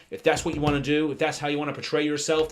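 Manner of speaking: ordinary talk, nothing marked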